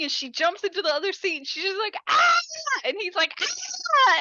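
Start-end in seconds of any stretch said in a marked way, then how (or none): 2.1-2.4 high, loud imitation shriek; short acted scream of shock; mock scream. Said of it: "then she starts screaming". 3.4-4.2 very high-pitched imitation yell; mock scream. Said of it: "and he starts screaming".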